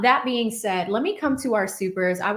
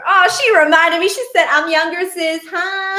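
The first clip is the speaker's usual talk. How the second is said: high, loud playful outrage; mock indignant surprise; 10 semitones higher, 11 dB louder than usual. Real, not acted.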